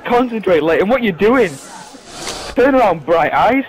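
loud, insistent telling-off; exasperated scolding. Real, not acted. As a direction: shout instructions at a hapless teammate. Berating a teammate in game.

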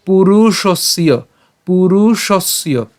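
পুরুষস্য slow, with a long second vowel; careful slow model of পুরুষস্য; syllable-by-syllable demo. Shown as a demonstration. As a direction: stretch পুরুষস্য out, leaning on the s. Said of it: "আমরা এখানে দন্তাস পর্যন্ত নিয়ে আসবো".

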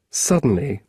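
ordinary delivery, nothing marked

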